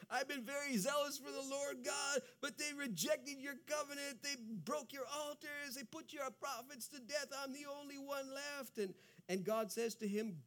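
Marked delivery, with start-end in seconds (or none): 0.1-8.8 anguished Elijah. Acted. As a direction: cry out the complaint as desperate Elijah. Elijah repeats his complaint, anguished.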